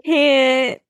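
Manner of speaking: high, slow, choked-up voice; barely getting the words out; overwhelmed. Real, not acted. Reader overcome after finishing the page, choked up. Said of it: "I literally can't".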